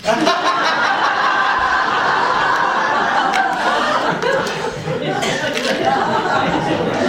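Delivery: long breathy giggling fit; real laugh. Real, not acted. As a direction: laugh helplessly at the prop. Teller laughs at sellotaped prop.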